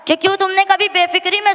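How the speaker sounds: normal talking voice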